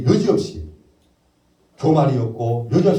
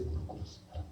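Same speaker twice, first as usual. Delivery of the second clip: whispered confession; 20 dB quieter than usual. Real, not acted. Confessing kneeling moment.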